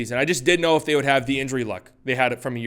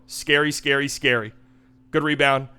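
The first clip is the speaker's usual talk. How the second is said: ordinary talk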